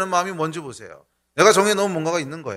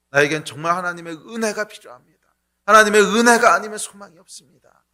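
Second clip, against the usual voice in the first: normal talking voice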